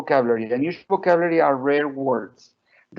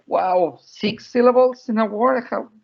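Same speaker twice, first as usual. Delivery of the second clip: put-on high-pitched, incredulous little-kid quote; imitated child's amazement; 7 semitones higher than usual. Mother retells daughter's reaction to six syllables, mock amazed. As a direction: act out a toddler boggling at it.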